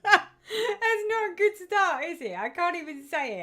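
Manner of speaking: loud, high laugh breaking through the words. Real, not acted. Vlogger laughing at fumbling a viewer's pattern name, high and loud. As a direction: laugh at tripping over the name.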